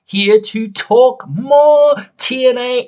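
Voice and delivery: high, slow, drawn-out announcer welcome; podcast host intro. Acted. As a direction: open the show like a big announcer.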